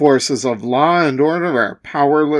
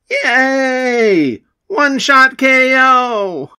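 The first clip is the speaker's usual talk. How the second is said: higher, excited cheer of victory; 7 semitones higher, 3 dB louder, slower than usual. Acted. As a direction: crow over the win. Winner cheers.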